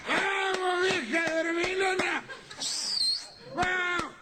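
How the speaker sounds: put-on high bellowing drunk yell; drunken shout at four in the morning. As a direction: holler up at the house like a drunk. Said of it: "a lo grito".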